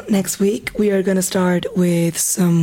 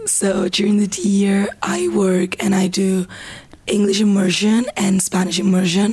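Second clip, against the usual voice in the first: hoarse, half-whispered, strained voice; losing her voice. Real, not acted. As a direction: push words out through a worn-out voice. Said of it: "Sorry for my voice".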